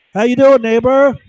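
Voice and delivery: high, loud fake cheer; mock friendly greeting. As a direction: play the two-faced neighbor all smiles. Neighbor waves while secretly harming.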